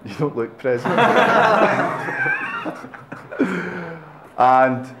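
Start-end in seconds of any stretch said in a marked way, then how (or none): 1.0-4.0 high, drawn-out burst of laughter; big high-pitched laughing fit. Real, not acted. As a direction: crack up helplessly at your own joke. Laughs at own roll-call joke.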